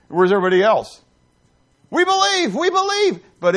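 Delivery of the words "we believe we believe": much higher, louder desperate cry; pleading outsiders. Acted. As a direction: cry out like the drowning crowd too late. People outside the ark pleading.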